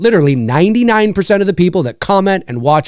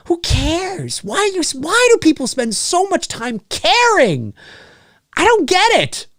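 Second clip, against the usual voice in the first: much higher, slowed exasperation; exasperated rant; 12 semitones higher, slower than usual. Real, not acted. Ranting about nasty commenters, exasperated and high. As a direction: throw up your hands in disbelief.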